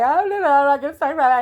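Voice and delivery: high, loud flustered babble; verklempt. Retold or imitated. Distraught caller to the bail bondsman, flustered high babble. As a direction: retell it as an overwhelmed, frantic ramble. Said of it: "I'm pretty verklempt".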